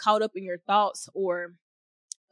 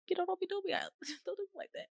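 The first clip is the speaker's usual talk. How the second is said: higher, soft, laughing through tears; tearful plea; 11 semitones higher, 7 dB quieter, faster than usual. Real, not acted. Speaker pleads with God through tears, soft and high. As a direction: plead gently while crying.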